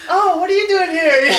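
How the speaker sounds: high, laughing fake surprise; hammed-up startled greeting through a laugh; feigned surprise. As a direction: act surprised for unexpected friends, badly faked. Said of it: "Act surprised".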